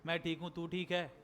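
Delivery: low monotone reply; expressionless. Shown as a demonstration. Teacher mimicking a friend's reply in lifeless conversation, expressionless. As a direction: answer on one note, without feeling. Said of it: "ये expressionless conversation".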